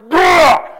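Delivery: loud high imitated growl; shouted retold snarl; mock growl. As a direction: growl it out like an angry old woman. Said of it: "She growled".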